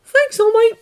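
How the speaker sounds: putting on a brave face; young Izuku. A narrator's reading. Izuku reassuring All Might as he leaves. Boy voice.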